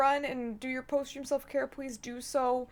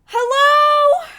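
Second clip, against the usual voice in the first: excited; 13 semitones higher, 16 dB louder than usual. Real, not acted. Greeting raiders arriving.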